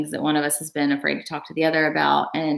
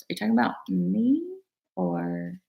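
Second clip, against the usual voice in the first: ordinary talk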